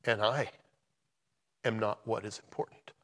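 halting, hushed, with long pauses; emotional. Real, not acted. Preacher sharing recent events in his life, emotional. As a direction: speak softly and haltingly, holding back feeling. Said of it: "I get all emotional about this stuff".

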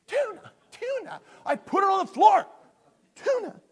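high, loud yelling at a clueless cat; hammed-up frustrated owner yell; exasperated shouting. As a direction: shout it way up in pitch, mock-frantic.

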